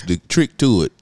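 normal talking voice

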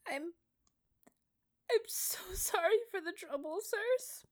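very high, tearful and halting; apologising through tears; crying. A narrator's reading. Peter sobs an apology to the officers, very high. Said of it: "Peter begins to cry again".

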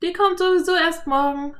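put-on high, quick girl reply; girl answering fast; quick Anne. A narrator's reading. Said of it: "antwortete Anne schnell". (schnelle Mädchenantwort)

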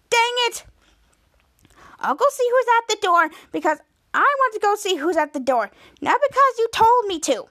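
high-pitched huffy retort; acted annoyed protest. Piper objecting to being told to answer door, huffy. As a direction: protest indignantly, voice pitched well up.

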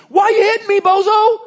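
high, loud, put-out donkey voice; the donkey complains to Balaam, high and loud; indignant donkey. Retold or imitated. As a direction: squawk it like a donkey getting beaten. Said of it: "And the donkey turns around and goes".

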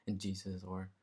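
ordinary delivery

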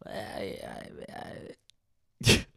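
whispered stammering I; mock stammer. The poser caught lying, stammering in a whisper.

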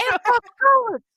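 high, loud teasing scold; mock telling off. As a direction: tease the kid loudly, half joking. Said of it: "E assim".

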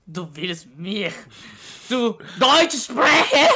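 slow, broken German; German speech. Pretend. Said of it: "quello è tedesco normale".

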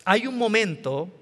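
ordinary talk, nothing marked

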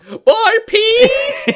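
very high, loud cartoonish squeak; silly squeaky quote. Friend squeaks movie quote for laughs, very high. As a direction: squeal the line like a goofy kid.

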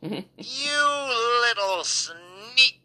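shrill Minnie Mouse shriek, slowly drawn out; high squeaky shriek. Retold or imitated. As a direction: screech it like a possessed cartoon mouse. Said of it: "shrieked at me in a high, squeaky voice".

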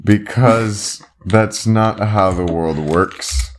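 slow, drawn-out explanation; slow explaining. Real, not acted. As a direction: spell it out slowly. Explaining to a kid.

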